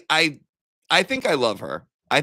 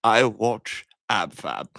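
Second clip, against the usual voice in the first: mock British; much slower than usual. Reading a fan's comment aloud.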